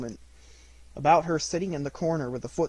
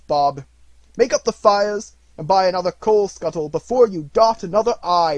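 put-on louder, jovial old man; hearty and jolly; 3 semitones higher, 9 dB louder than usual. A narrator's reading. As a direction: beam with generosity. Scrooge joyfully generous.

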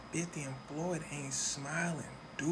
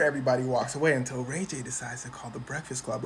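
ordinary talk, nothing marked

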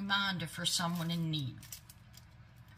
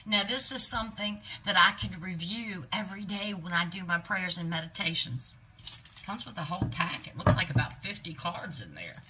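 ordinary delivery

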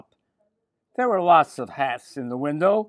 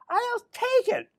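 put-on very high, slow little-girl voice; Millie. Millie decides to buy the hat, very high girlish voice. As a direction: squeal it out slowly in falsetto. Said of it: "she said".

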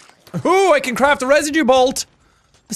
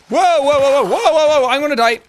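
louder, rapid panicked whoas; alarmed; 4 semitones higher, 4 dB louder than usual. Real, not acted. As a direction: panic as the danger hits. Alarm in game fight.

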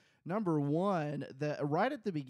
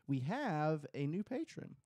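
ordinary delivery

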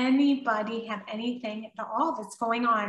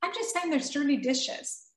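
defensive indignation; 6 semitones higher than usual. Retold or imitated. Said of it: "I could have been like".

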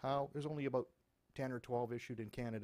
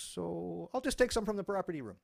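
mock cop voice; 5 semitones higher, 9 dB louder than usual.

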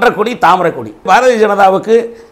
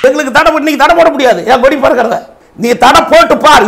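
raised, loud defiance; heated challenge; 6 semitones higher, 4 dB louder than usual. Real, not acted. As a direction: push back hard, high and forceful. அரசியல் விவாதத்தில் சவால் விடுகிறார். (ஆவேசமாக சவால் விடும் குரல்)

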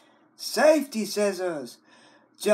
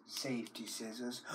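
ordinary talk

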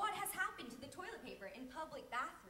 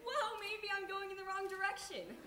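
mock realisation; 5 semitones higher, 4 dB louder than usual.

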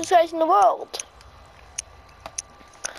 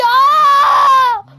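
startled yell; 7 semitones higher, 7 dB louder than usual. Acted. Crashing the car.

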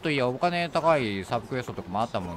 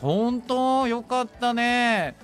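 high, loud and drawn-out delight; delighted congratulations; 10 semitones higher, 4 dB louder, much slower than usual. Pretend. Fan reacting to the singer's scouting, playful delight. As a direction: cheer for her, stretching the ending.

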